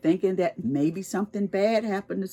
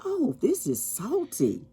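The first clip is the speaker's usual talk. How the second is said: surprised; 8 semitones higher, 6 dB quieter than usual. Retold or imitated. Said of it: "and you licked it and you said".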